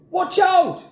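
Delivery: very high, loud warning yell; alarmed cry. A narrator's reading. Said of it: "cried the boy".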